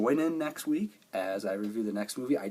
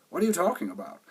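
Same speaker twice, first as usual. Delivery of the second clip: surprised; 9 semitones higher, 5 dB louder, faster than usual. Retold or imitated. Xavier's confused quoted reply.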